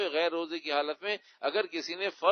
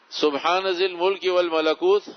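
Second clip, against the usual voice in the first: louder, chanted recitation of the Arabic words; reciting the prayer; 8 dB louder than usual. Shown as a demonstration. Mufti recites the dua aloud to the questioner, chanting. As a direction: intone the dua for the asker. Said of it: "کیا ہے آگے؟".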